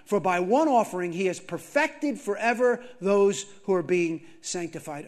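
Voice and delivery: loud, high-pitched scripture proclamation; fervent preaching. Acted. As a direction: preach the verses at full force, punching key phrases. Preaches scripture at full force.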